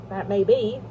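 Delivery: ordinary delivery, nothing marked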